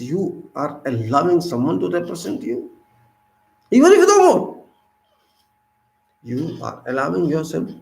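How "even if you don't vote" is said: emphatic. Real, not acted. Impassioned rhetorical speech.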